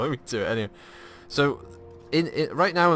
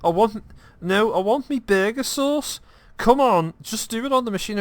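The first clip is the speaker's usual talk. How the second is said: high, loud put-on mum demanding her sauce; a hammed-up Scouse mum insisting on her burger sauce; indignant mum; 11 semitones higher, 6 dB louder than usual. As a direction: whine it like an impatient mum who won't wait. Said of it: "She gets really indignant".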